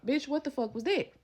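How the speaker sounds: calling after the friend, furious; angry yell. Retold or imitated.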